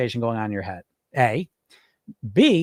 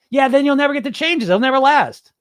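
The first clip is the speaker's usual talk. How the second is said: high, loud naysaying voice; acted outburst, pitched way up and loud; shrieking inner critic; 10 semitones higher, 6 dB louder than usual. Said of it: "It's just shrieking".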